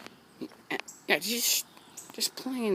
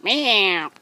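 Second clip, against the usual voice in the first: cat meow; 4 semitones higher, 10 dB louder than usual. Pretend. Imitating cat back at Charlie.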